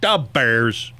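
lightly imitated Chicago accent; a hint of flat Chicago speech. Shown as a demonstration. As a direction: say it like a Chicago sports fan. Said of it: "That's Chicago".

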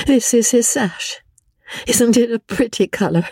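high, wild, distraught voice; lost in grief, half out of her mind; wilder tone. A narrator's reading. Amelia raves to Rebecca about George, wild and high.

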